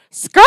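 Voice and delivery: loud drawn-out "skrrt" like skidding tyres; mock tyre screech. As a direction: screech it like a car swerving away. Imitates car skidding into U-turn.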